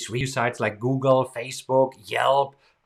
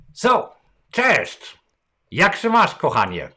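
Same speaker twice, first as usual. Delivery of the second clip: bright, smiling Polish hello, pitched up; big smiling greeting; 4 semitones higher, 3 dB louder, slower than usual. Retold or imitated. Greeting the two receptionists, with a big smile. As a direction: beam as you greet them. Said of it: "so I smile big".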